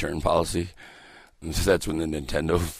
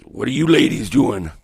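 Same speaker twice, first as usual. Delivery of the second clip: hammed-up, loud, higher come-on; smooth pickup artist; 7 semitones higher, 10 dB louder than usual. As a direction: imitate a would-be ladies' man. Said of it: "smooth as fucking polyester".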